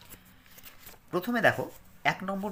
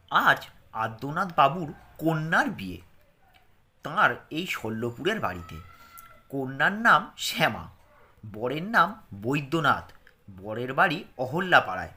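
careful reading aloud; 6 dB louder than usual. Shown as a demonstration. Reads second lesson aloud.

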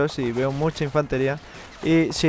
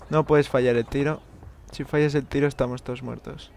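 ordinary delivery

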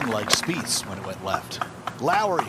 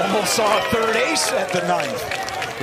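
excited call; 8 semitones higher, 5 dB louder, slower than usual. Real, not acted. Near ace, excited call.

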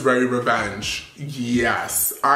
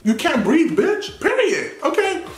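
much higher, punchy hype; sassy hype; 13 semitones higher than usual. Real, not acted. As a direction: hype the dress up like a diva. Raving about best dress.

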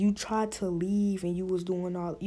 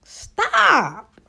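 exasperated; 9 semitones higher, 7 dB louder than usual. Real, not acted. Exasperated at the absurdity.

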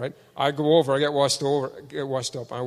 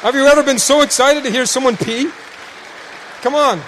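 louder, excited preacher appeal; fervent preaching; 6 semitones higher, 7 dB louder than usual. Real, not acted. Preacher stirring the congregation, loud and excited. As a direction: rouse the crowd.

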